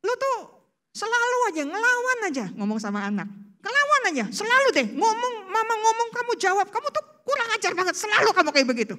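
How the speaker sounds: high, fast scolding tirade; angry scolding mom. Retold or imitated. Preacher acts out a mother scolding her back-talking child, furious. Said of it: "Itu mamah-mamah lagi emosi tinggi".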